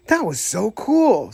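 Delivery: drawn-out gushing praise. A narrator's reading. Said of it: "Snappy gushed".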